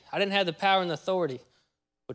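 ordinary talk, nothing marked

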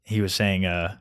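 ordinary talk, nothing marked

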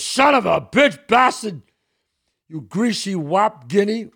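loud, slow, furious insults; raging teacher. Retold or imitated. Jesuit brother screaming at the boy, loud rage. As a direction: roar it like a brother losing it. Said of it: "he goes".

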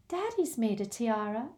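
piped up, light and dreamy; dreamy Luna. Acted. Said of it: "piped up Luna".

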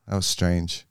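usual speaking voice

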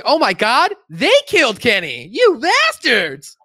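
high, loud cartoon cry, last word drawn out; hammed-up catchphrase yell; mock outrage. Friends crying out at Kenny's death, mock outrage.

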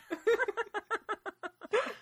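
laughing at the dragon name bit; high laugh. Real, not acted. Host laughs high at the dragon naming joke.

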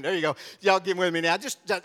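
ordinary talk, nothing marked

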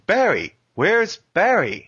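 high, slow B-movie hero call; mock Resident Evil voice. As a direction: call out like bad game dialogue. Aping old Resident Evil acting.

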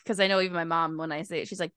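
normal talking voice

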